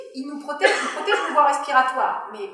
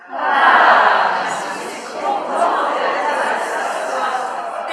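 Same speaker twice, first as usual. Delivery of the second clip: breathy, wide-open airy tone, slowly; open false vocal folds; 3 semitones higher, 8 dB louder, much slower than usual. Shown as a demonstration. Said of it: "vous avez ouvert en grand vos fausses cordes vocales".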